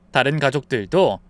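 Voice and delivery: plain reading voice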